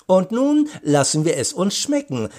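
high, lilting call to eat; cheerful call. A narrator's reading. Aunt invites guests to eat, cheerfully calling. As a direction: call it out high, without shouting. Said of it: "rief Tante Rosemarie".

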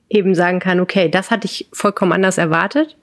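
ordinary delivery

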